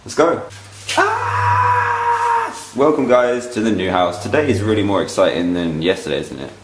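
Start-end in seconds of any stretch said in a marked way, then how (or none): 1.0-2.5 high excited squeal. Real, not acted. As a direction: squeal with delight. Excited arrival at new house.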